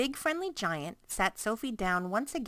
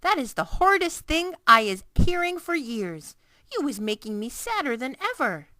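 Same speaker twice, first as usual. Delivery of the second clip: loud, higher distressed cry; loud upset giant; 7 semitones higher, 9 dB louder than usual. A narrator's reading. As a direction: let the giant's upset burst out. Giant upset after outburst.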